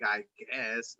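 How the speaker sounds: quiet, resigned mumble; deflated "I guess". Retold or imitated. Answering the party, quiet and defeated. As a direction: let the words fall away, beaten.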